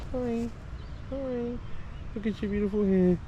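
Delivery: soft, high cooing to a horse. Real, not acted. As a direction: coo gently at the animal. Talking softly to a horse.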